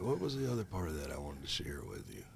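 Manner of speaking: whispering to himself mid-story; whispered aside. Real, not acted. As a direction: mutter it, searching memory.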